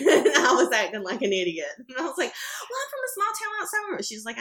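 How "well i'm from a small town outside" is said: much higher, lightly hammed-up naive voice; mock clueless self. Telling the fan where she's from, mock naive and high. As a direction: play your past self as a bit dense.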